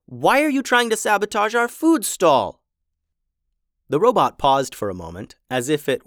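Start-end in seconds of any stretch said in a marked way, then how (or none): 0.1-2.6 higher, louder accusing demand; angry confrontation. Acted. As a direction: demand it angrily, pitched up. Louisa confronts the robots.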